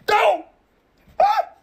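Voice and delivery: high shrill yelp of pain; pained scream. Acted. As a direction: scream as if scalded. Burning on stove.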